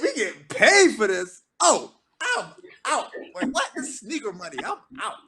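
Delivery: high, excited disbelief; retold astonishment. Singer learns the gig pays, high-pitched astonishment. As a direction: blurt it high in amazed bursts, hammed up for the story. Retells shock at getting paid.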